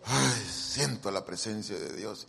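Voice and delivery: usual speaking voice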